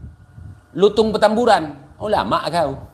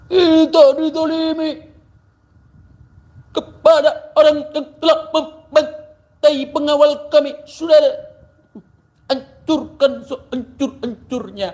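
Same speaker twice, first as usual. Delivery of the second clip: mock preacher; 8 semitones higher than usual. Mocking rival cleric's sermon.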